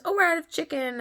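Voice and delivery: high-pitched imitated vendor apology; apologetic taco seller. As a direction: break bad news sweetly as the seller. Acting seller breaking bad news.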